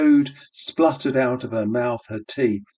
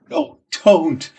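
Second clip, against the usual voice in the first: cried out; 7 semitones higher, 5 dB louder than usual. Acted. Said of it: "she cried".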